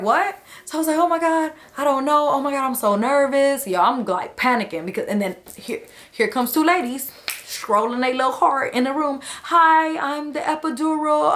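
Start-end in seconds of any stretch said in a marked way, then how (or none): 0.0-3.7 high, panicky retold freakout; nervous mother-to-be, high and panicked. Panicked self quoted, much higher. 9.4-11.3 chirpy greeting. Retold or imitated. Chirpy epidural ladies arriving.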